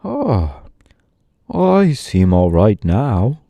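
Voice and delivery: whispered sighing oh, then slow. A narrator's reading. Sawhorse sighing after being set upright, slow relieved. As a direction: breathe it out with relief. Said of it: "with a sigh".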